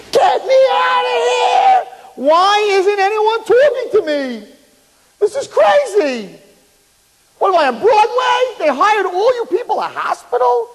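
drawn-out, very high frantic scream. Retold or imitated. Patient in surgery whom nobody answers, screaming. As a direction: scream high and slow to be heard. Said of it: "he's screaming".

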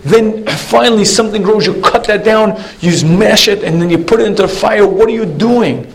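high, exasperated mock rant; city man screaming at the farmer; mock screaming tirade. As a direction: list the outrages with rising disbelief. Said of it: "screams out".

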